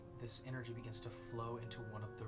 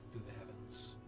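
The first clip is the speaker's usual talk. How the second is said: ominous narration; 3 dB quieter than usual. A narrator's reading. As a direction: paint the vision with dread. GM dark scene narration.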